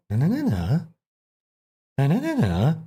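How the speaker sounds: put-on Valley Girl cadence. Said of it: "he has, like, this Valley Girl".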